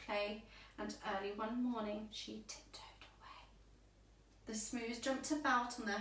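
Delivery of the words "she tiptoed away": whisper. A narrator's reading. Tiptoeing away quietly.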